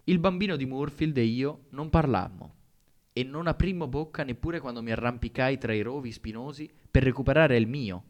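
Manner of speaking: ordinary delivery, nothing marked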